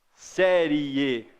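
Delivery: "Série" drawn out, the I gliding into E; slow teaching "Série"; semivowel demo. Shown as a demonstration. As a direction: stretch the ending of "Série" to show both vowels. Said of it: "Olha como que esse I é semivogal".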